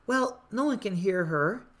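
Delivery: ordinary talk, nothing marked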